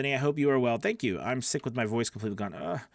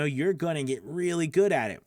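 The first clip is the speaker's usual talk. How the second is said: ordinary talk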